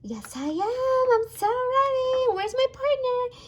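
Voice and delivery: high-pitched eager toy character voice; Freddy. Pretend. Toy answers that it is ready to deliver, eager high voice. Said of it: "Hey, Freddy".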